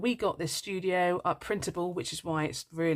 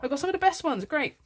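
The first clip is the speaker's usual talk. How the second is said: much higher, bright rush of delight; thrilled squeal; 13 semitones higher than usual. Retold or imitated. Retelling unboxing her favourite game, squeaky delight. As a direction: squeak it out with glee. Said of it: "And I was like".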